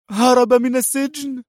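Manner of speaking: shocked cry. A narrator's reading. Sonia hearing of the escape, a high shocked cry. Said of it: "صرخت سونيا ... الذهول والرعب".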